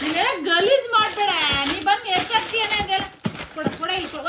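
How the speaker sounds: loud, high, bossy telling-off; real scolding shout. Real, not acted. Mother telling her son not to make a mess, loud scolding. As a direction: shout over him to hand it over.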